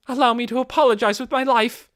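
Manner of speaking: fervent shouted offer of her life; loud dramatic vow. Acted. Loyal maid loudly offering her life to Ainz. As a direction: declare it with total devotion.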